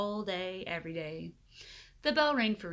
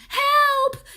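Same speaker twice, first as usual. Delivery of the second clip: very high, loud desperate call; cry for help; 16 dB louder than usual. A narrator's reading. Paul dangles from the window, yelling for help. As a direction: scream for help, hanging on. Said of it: "gasped Paul".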